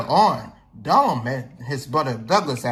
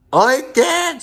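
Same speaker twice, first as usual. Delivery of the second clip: mild mock yell; 8 semitones higher, 6 dB louder than usual. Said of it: "yelled Donald".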